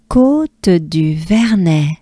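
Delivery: Côte du Vernet, slow and clear; careful teacher pace; slow model. Shown as a demonstration. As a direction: say it slowly. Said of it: "Let's take a good breath".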